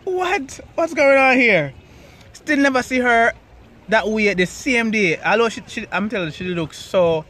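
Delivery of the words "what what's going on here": surprised. Retold or imitated. Retold baffled reaction.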